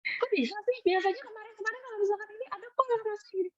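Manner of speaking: high, soft mock-incredulous friend; imitated disbelief. Retelling friend's puzzled reaction.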